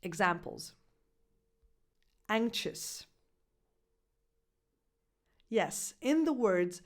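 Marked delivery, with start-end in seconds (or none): 2.3-3.1 anxious said ANK-shus; X as ksh. Shown as a demonstration. Coach demonstrates anxious with ksh. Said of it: "The third sound ... is this one".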